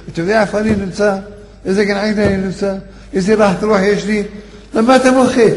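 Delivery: a voice from heaven scolding the mourner; pleading reproach. Acted. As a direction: call out to stop the weeping. Dead brother speaks in dream.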